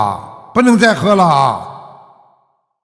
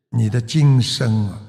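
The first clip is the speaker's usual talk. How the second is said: ordinary talk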